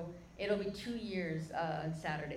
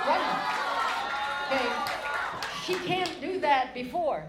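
excited emphasis; 6 semitones higher, 10 dB louder than usual. Acted. Preacher telling the crowd she ran, loud and amazed. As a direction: shout the wonder of it.

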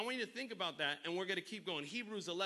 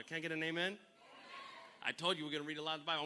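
normal talking voice

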